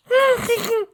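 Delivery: put-on squeaky little-girl laugh; tiny high giggle. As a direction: giggle high like a small filly.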